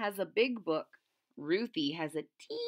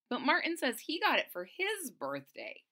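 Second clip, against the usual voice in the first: plain reading voice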